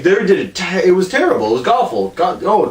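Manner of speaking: normal talking voice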